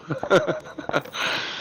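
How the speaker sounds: a soft, hushed little chuckle; quiet snicker. Real, not acted. Telling Painless about the boot ride, a quiet snicker. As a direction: snicker under the breath at a dark joke. Laughs at own plan.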